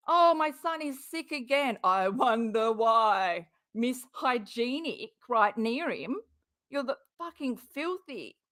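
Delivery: a mean parody of the mum; mocking mother. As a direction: mimic her fake worry with contempt. Partner mocks mother's words.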